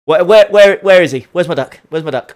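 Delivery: loud, urgent repeated "where"; panicked hunt for the duck; frantic search. Real, not acted. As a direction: call it out searching.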